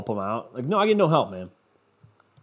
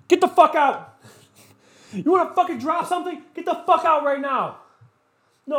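put-on high-pitched screaming dad yelling at his kid; angry dad; 14 semitones higher than usual. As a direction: shriek at the kid like a furious parent losing it.

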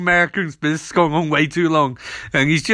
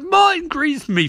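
high petulant; 12 semitones higher than usual. Retold or imitated. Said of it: "And Ronnie's like".

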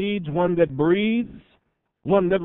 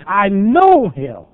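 confident declaration; 4 semitones higher, 9 dB louder, much slower than usual. Pretend. Declaring faith after revelation.

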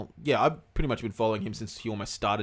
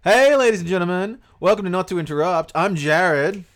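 loud upbeat show host; announcer intro; 6 semitones higher, 11 dB louder than usual. Acted. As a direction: welcome them like a radio host. Podcast intro, announcer style.

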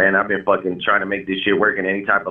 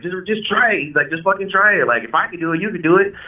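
much higher, urging just try it; brother's eager push; 8 semitones higher than usual. Retold or imitated. Older brother pushing him to rap over Forever, high and eager. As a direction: egg him on to go for it.

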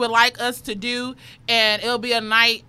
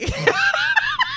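high shrieking laugh. Real, not acted. Big laugh at prompt.

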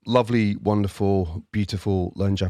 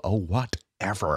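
put-on slow cynical Valley Girl whatever; 4 dB quieter, slower than usual. Shown as a demonstration. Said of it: "my nineteen nineties Valley Girl impression".

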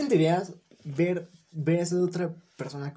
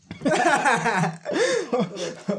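high laughter; 6 semitones higher than usual. Real, not acted. Both laughing.

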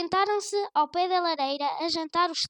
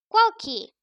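higher and louder scoffing retort; dismissive retort; 5 semitones higher, 9 dB louder than usual. A narrator's reading. Grandmother brushing off the thief, a loud scoff. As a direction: wave him off boldly.

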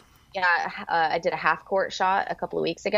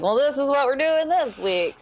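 breezy announcement; 9 semitones higher than usual. Retold or imitated. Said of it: "I'm like".

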